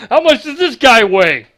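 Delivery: hammy announcer voice. Retold or imitated. Echoing the bellowing announcer bit.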